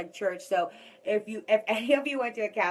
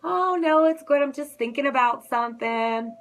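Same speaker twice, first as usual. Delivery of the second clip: forced bright denial, higher and louder; fake-cheerful brush-off while hiding sadness; 4 semitones higher, 5 dB louder than usual. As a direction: cover it up with a chirpy high no. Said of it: "And I'm like".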